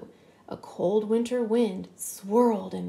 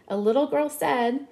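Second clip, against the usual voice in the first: ordinary delivery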